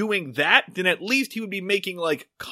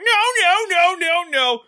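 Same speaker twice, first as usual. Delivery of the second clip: high-pitched helpless fussing; hammed-up squealing fit; impotent protest; 12 semitones higher, 4 dB louder than usual. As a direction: flail vocally in feeble, panicky objection. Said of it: "impotently waves his tiny, slender arms".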